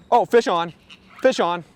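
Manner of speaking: sudden excited shout; excited call. Real, not acted. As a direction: call out the hooked fish. Hooks a fish, calls it out.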